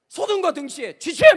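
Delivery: loud barrack call; mock drill call. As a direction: bark lights-out like a Marine sergeant. 아버지 점호 구령.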